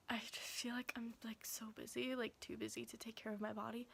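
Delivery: soft whispered confession; whispering. Real, not acted. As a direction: drop to a whisper, almost embarrassed.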